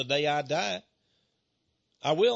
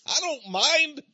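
laughing, drawn out; 11 semitones higher, 3 dB louder, slower than usual. Real, not acted. Joking about being heaven's janitor.

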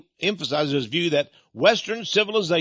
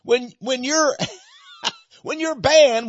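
laughing through the words, pitched higher and slower; gleeful laugh at being banned; 11 semitones higher, 3 dB louder, slower than usual. Real, not acted. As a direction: chuckle with glee as you say it. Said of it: "And that's why I'm laughing".

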